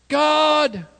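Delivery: high, loud confident cry; bold shout. Retold or imitated. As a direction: call out with full courage. Bold call to God again.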